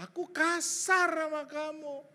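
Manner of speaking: loud, high, slow "Aku kasar sama kamu"; emphatic confession. Shown as a demonstration. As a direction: proclaim the admission with stretched words. Exaggerated repeat of the apology.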